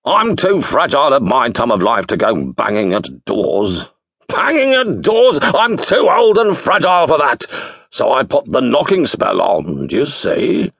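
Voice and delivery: put-on theatrical booming old wizard. A narrator's reading. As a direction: boom it out like a grand wizard. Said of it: "he bellowed".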